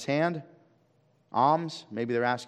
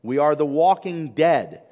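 higher, slow and loud proclamation; hollering out; 4 semitones higher, 7 dB louder, slower than usual. Acted. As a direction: holler it out like an outcast warning people. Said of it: "they had to holler out".

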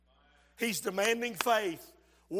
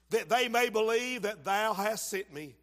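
normal talking voice

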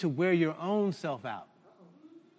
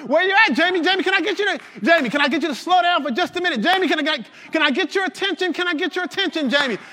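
Jesus calling out to Jamie; 10 semitones higher, 9 dB louder, faster than usual. Acted. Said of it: "Got Jesus chasing you around, saying".

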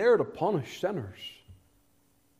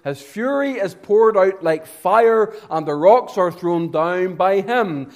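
loud, raised, driving sermon voice; full-force preaching; 6 semitones higher, 7 dB louder than usual. Real, not acted. Preacher telling man or woman of God's fiery presence, full-force preaching. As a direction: build the warning with hammered key words.

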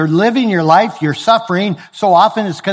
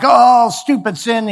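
a higher, slow, mock-annoyed grumble at the sin; hammed-up irritation, pitched up and drawn out; mock frustration; 7 semitones higher, slower than usual. Believer tripped by sin.

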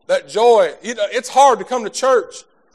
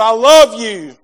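higher, louder, earnest pleading; God's loving voice; 6 semitones higher, 7 dB louder than usual. Acted. God calling to the congregation, rising earnest voice. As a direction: speak as God calling tenderly but full. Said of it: "God may be whispering in your heart".

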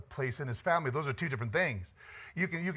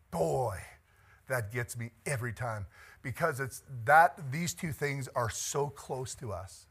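ordinary delivery

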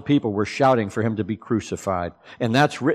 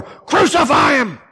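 mock crowd shout; 13 semitones higher, 4 dB louder, slower than usual. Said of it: "they cried out all the more".

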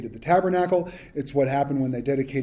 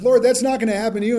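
quick, raised protest; mock protest; 6 semitones higher, 4 dB louder, much faster than usual. Peter blurting a protest to Jesus, quick and raised. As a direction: blurt it out fast like eager Peter.

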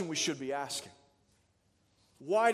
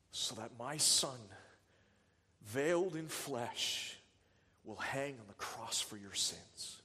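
the Father, softly; 5 semitones lower, 5 dB quieter than usual. Acted. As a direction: let God's promise of the cross fall quietly. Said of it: "What did the Father say?".

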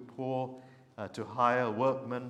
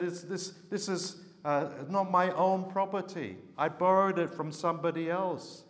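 pleading higher, anxious and pressed; despairing plea; 6 semitones higher, 3 dB louder than usual. A narrator's reading. Young prophet pleading with Elisha about the borrowed axe, higher despairing voice. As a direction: beg for help, sure the debt can't be paid. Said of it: "behind this cry of despair".

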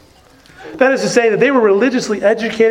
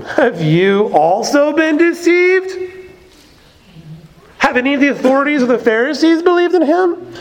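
higher, scornful mock of the Pharisees; sneering question; 6 semitones higher than usual. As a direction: read it as a sneer at the guards. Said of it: "a further sneering question".

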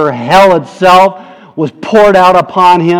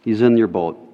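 normal talking voice

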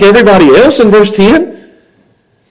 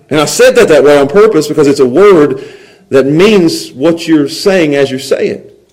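ordinary talk, nothing marked

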